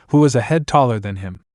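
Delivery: ordinary delivery, nothing marked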